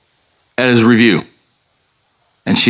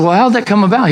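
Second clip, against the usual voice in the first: curious question; 10 semitones higher, faster than usual. Retold or imitated. Father questions son in story.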